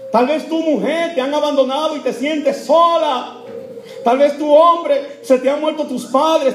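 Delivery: high, loud preacher's appeal; impassioned preaching. Real, not acted. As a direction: preach it out to the whole congregation. Preaches to the lonely at full force.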